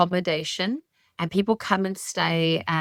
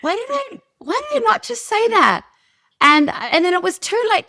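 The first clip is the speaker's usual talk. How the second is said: much higher, exasperated self-reproach; 14 semitones higher, 4 dB louder than usual. Retold or imitated. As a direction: squeak out why didn't I. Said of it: "I'm like".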